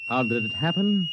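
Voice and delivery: normal talking voice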